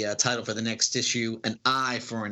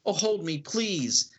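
higher, mock-frightened pleading; frightened plea; 7 semitones higher than usual. Empress clinging to Leland after an attack, mock-frightened and pleading. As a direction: beg him to hold you, playing scared.

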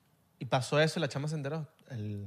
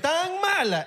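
ordinary delivery

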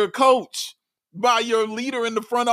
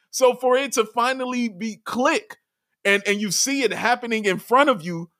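ordinary delivery

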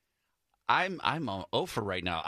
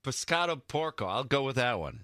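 usual speaking voice

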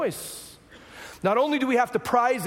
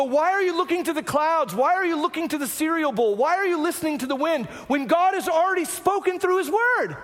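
exasperated rebuke; 8 semitones higher than usual. Retold or imitated. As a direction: ask them why, as if they should know better. Preacher voices his own rebuke.

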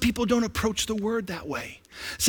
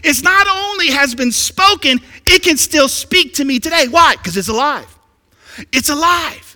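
high, loud, fervent declaration; excited preaching; 7 semitones higher, 6 dB louder than usual. Real, not acted. Preacher tells congregation the Word still speaks, excited. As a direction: fire it out with rising excitement. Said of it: "excuse me if I get excited".

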